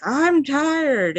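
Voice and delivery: high, loud cranky yell; sleepy man yelling back. Retold or imitated. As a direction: shout it back, fed up. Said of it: "he, like, yelled back".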